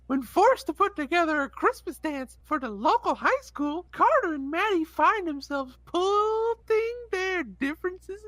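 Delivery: elf voice. Acted. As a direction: read it like a cheerful elf. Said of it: "sound like a elf and a clown".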